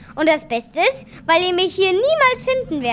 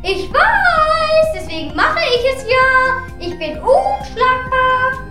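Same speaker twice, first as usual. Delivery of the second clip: smug boasting; 6 semitones higher, 3 dB louder, slower than usual. Acted. Boastful hidden god.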